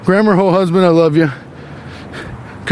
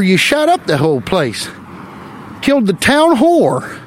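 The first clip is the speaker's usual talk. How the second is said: put-on old western townsfolk; 5 semitones higher than usual. Townsman scolding the town drunk in a mock western voice. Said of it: "people were just like".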